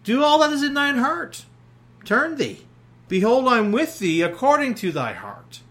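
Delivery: loyal armor bearer. Acted. Armor bearer answers Jonathan, high and eager. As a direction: rise up high and devoted. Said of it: "And his armor bearer said unto him".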